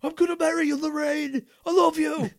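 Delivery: high, loud put-on Biff voice. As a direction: ham up the bully. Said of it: "That was Biff".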